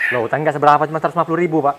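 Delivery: high, loud protest in disbelief; husband shouting at the shopkeeper for blaming his wife; indignant shout. Acted. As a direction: shout back in outrage.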